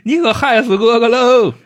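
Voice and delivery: high, loud, slow drawn-out wail; pained reproach. Acted. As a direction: lament it in hammed-up agony. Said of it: "说埋怨老三".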